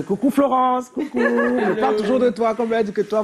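high, singsong hello to an absent friend; real laughing, high-pitched greeting; playful shout-out. Real, not acted. Greets absent colleague Florence on air.

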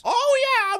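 excited cheer. Real, not acted. Cheering on finding his team.

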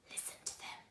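hushed breathy whisper, no voice; old man hushing James over the bag, whispering. Acted. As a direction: whisper it like a secret. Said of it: "the old man whispered".

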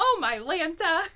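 put-on high, loud laughing toddler's oh my Lanta; imitating a toddler. Host imagining a two-year-old saying it, laughing. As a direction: mimic a little kid while laughing. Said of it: "hearing a little two-year-old".

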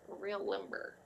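very soft, suggestive murmur; suggestive aside. Acted. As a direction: drop to a near whisper, flirting.

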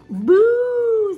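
loud, high "boo"; big high-pitched playful scare; princess's boo. A narrator's reading. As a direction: shout boo to scare a ghost. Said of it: "said the little princess".

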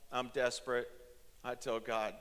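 ordinary talk, nothing marked

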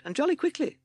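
high, nervous toad voice; timid toad. A narrator's reading. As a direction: add it nervously, pitched high. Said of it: "said timid Toad".

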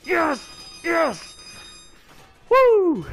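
high, loud victory shouts; excited celebration. Real, not acted. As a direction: yell it like you just won. Winning the match, celebrating.

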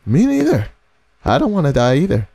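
high-pitched squeal falling back down; squeaky, frightened reply; scared agreement. Acted. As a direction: squeak it out in mock panic. Scared reply to game character's line.

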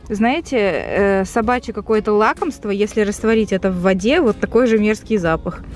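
ordinary talk

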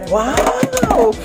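high, long-held wow; amazed. Real, not acted. Vlogger seeing the food revealed, amazed. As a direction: marvel at it in a soaring voice. Admiring the opened pot.

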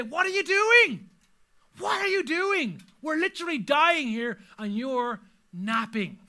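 high, loud, drawn-out disbelief; slow, exasperated yell at someone napping; panicked outcry. Acted. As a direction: cry out like terrified disciples waking a sleeper. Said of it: "they wake him up and say".

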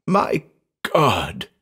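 drawn-out moan of horror; low moan. A narrator's reading. Man seized by terror before his friend, moaning. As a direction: moan it, sliding down. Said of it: "he moaned in a low voice".

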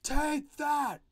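"Take that!" shouted high and loud; yelled. Shown as a demonstration. Teacher voices a comic heroine yelling at a foe. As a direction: punch it out like a battle cry. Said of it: "and then she yells out that".

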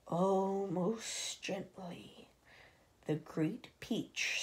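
very slow, soft, almost whispered; stealthy whisper; hushed slow narration. A narrator's reading. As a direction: creep the words along. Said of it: "narrator slows, hushes".

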